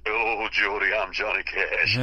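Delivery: slow, drawn-out star's greeting. Retold or imitated. Country star greeting a young singer through car window, slow impression. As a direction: stretch out a country legend's hello. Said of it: "[name] stuck his he-, head in the car".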